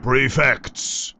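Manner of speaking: stern headmaster. Acted. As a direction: call the prefects firmly. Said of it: "he says in a stern voice".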